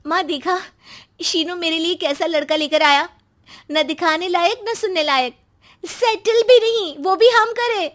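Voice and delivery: high, rising indignant tirade; angry complaint. Acted. Sister complaining to mother about brother's suitor, angry. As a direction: build in pitch and volume to an outraged peak. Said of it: "उसे भाई पर गुस्सा आ जाता है".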